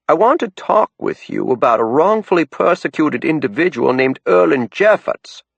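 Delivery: put-on a caller with a thick down east Maine accent. A narrator's reading. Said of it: "thick as a down east fog bank".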